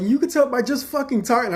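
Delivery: high, loud indignant burst; swearing outburst. Retold or imitated. Arguing with the tester, loud exasperated swearing. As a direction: throw the swear back at her, exasperated. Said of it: "I started swearing".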